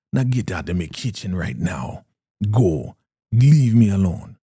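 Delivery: mock angry order. Elder Mother sending River from her kitchen, mock angry. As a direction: shoo them out, dragging the last words.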